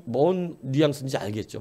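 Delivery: ordinary talk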